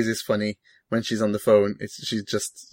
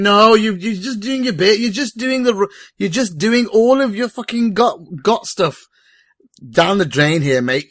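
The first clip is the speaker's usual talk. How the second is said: exasperated rant; 8 semitones higher, 5 dB louder than usual. Retold or imitated. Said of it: "I was watching it going".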